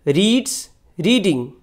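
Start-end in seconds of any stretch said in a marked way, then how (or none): none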